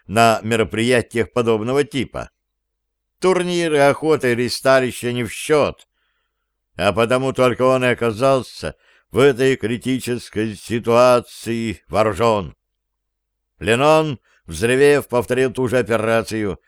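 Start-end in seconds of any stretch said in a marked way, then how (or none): none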